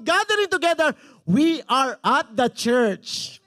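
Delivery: loud preacher's proclamation; impassioned preaching. Real, not acted. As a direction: drive it home to the congregation, stretching key words. Preaches at full force.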